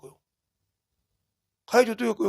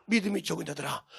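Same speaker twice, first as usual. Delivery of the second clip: hushed reproach; 5 semitones lower, 9 dB quieter than usual. Acted. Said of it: "내 자식, 내 제자들 보고".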